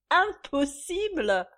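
high, loud, slow mockery; sneering echo. A narrator's reading. Mistress mocking the naive visitor, sneering. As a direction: stretch the word in scorn. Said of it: "ricana Suzanne".

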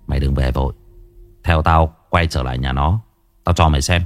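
put-on low, steady older-brother voice; composed older brother. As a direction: take charge in a low, assured tone.

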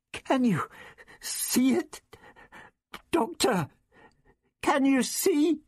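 put-on high, anxious girl's voice; frightened girl. A narrator's reading. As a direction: call out blindly in fear, pitched high. Terrified girl in blinding light.

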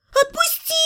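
very high wailing cry. Acted. Heroine wails to be released, very high and anguished. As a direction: howl it in despair. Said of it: "а выла я".

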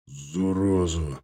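slow, low, hoarse mumble. Acted. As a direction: mumble it hoarsely under strain. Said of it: "промычал Уткин хрипло".

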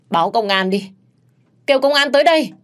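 acted outcry to bystanders; calling for help. A narrator's reading. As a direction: cry out for the police. Accuser calls out for police.

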